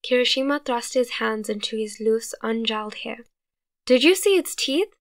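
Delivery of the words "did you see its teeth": high, loud panicked question; near-hysterical fear. A narrator's reading. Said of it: "he said hysterically".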